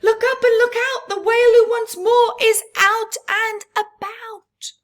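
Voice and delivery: high, loud, fast alarm call; shouted warning. A narrator's reading. Small crab warning the reef of the whale, shouting. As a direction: sound the alarm at full voice. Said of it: "Crystal shouted".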